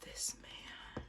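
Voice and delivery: awed whisper; hushed whisper at the photo. Real, not acted. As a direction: breathe it like a secret.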